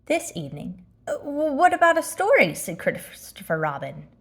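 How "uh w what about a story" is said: put-on child's voice. Christopher Robin voiced by narrator.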